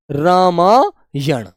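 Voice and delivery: रामायण said very slowly, each sound drawn out with a clear retroflex ण at the end; careful pronunciation. Shown as a demonstration. Teacher models रामायण for the class, slowly and clearly. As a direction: stretch out रामायण syllable by syllable so the ण is heard. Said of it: "रामायण बोला जाएगा बेटा".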